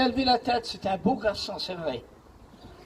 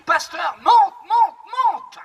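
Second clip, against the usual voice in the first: high, loud, frantic call to climb in; retold shout of a panicked father; urgent shout; 6 semitones higher, 5 dB louder, slower than usual. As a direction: yell it like there's no time. Father urging pastor into car.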